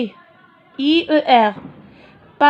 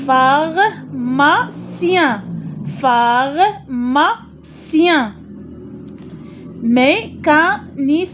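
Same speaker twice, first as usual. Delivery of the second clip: each word slow and drawn out; slow dictation; slower than usual. Shown as a demonstration. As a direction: read each word slowly for pupils. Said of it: "كلهم كيتعاودوا في المقطع الأخير".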